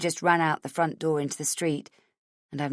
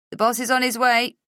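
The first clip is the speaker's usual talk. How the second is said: raised, louder voice from a radio; 7 semitones higher, 6 dB louder than usual. Acted. Radio announcing the boss, raised. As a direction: call it through static.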